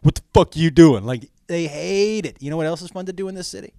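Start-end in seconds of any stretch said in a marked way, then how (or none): 0.0-1.0 loud, irritated heckling; not-so-quiet grumble. Retold or imitated. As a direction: curse loudly at someone in your path. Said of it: "under their breath and not so under their breath".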